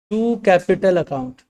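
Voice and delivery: slow, even, word by word; slow dictation. Shown as a demonstration. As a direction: dictate it for notes. Dictating the journal entry. (धीमे से लिखवाना)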